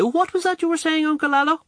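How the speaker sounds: very high, airy question; feigned interest. A narrator's reading. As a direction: turn away and ask the uncle in a high voice. Snubbing Nugent, turns to uncle.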